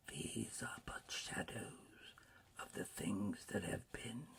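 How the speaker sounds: soft, whispery ghost voice; hushed spirit. A narrator's reading. Ghost explaining shadows of the past, hushed. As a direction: explain in a slow, airy hush.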